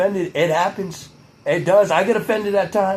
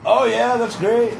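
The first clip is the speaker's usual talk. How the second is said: Donald Trump impression; 6 semitones higher than usual. Greeting the delivery man in a Trump voice, blustering praise. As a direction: gush over the delivery guy like a politician. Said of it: "Who am I impersonating ... Donald Trump".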